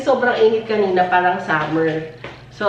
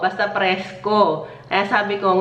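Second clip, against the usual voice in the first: ordinary talk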